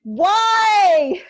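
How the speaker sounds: loud, high cry of why; yelling why. Real, not acted. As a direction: let the rage out with a yell. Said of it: "We're gonna start".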